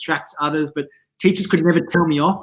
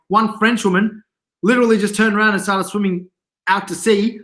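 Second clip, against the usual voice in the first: ordinary talk, nothing marked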